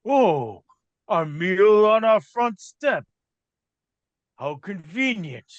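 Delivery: drawn-out brute delight; hungry monster. Acted. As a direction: gloat slowly like a hungry ogre. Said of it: "and he says".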